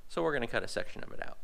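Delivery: ordinary talk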